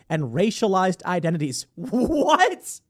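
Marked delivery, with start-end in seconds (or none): 1.7-2.8 high, rising incredulous cry; stretched high-pitched bewilderment; laughing disbelief. Real, not acted. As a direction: let a laugh burst into a high question.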